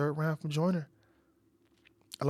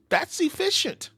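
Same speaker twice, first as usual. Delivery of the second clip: impressed; 12 semitones higher, slower than usual. Real, not acted. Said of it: "repeated with emphasis".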